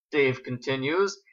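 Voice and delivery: usual speaking voice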